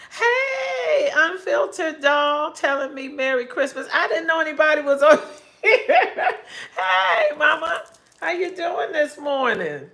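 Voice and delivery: high, loud, delighted hello with a laugh; excited greeting. Real, not acted. Greeting Unfiltered Doll in the chat, thrilled and loud.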